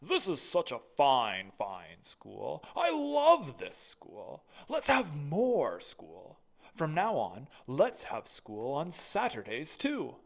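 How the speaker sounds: loud, slow, proud school principal; booming headmaster. Acted. Mister Keene's voice.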